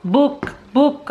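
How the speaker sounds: put-on high, eager one-word toddler demand; high-pitched child-like pestering; imitating toddler. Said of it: "and say".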